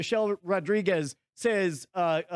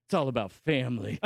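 lower, gravelly-free mock tough guy; mock movie-star hero, lower; gruff action hero; 10 semitones lower than usual. Hero's catchphrase.

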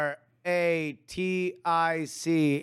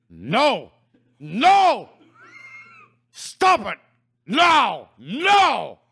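frantic shouted no; shouting; 8 semitones higher, 8 dB louder, much slower than usual. Acted. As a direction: yell to make it stop. Acted protest, shouting.

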